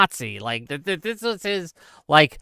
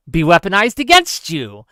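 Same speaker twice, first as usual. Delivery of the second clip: usual speaking voice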